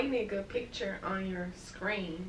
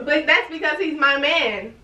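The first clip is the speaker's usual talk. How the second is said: loud, raised-pitch argument; heated protest; 4 semitones higher, 8 dB louder than usual. Real, not acted. Girlfriend claiming her man, heated protest. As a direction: stake the claim loudly and forcefully.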